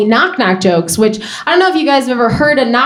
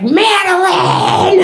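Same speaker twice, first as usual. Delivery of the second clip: slow, high drawn-out shout of a name; toddler calling out; 8 semitones higher, much slower than usual. Retold or imitated. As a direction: call her name like a kid popping up.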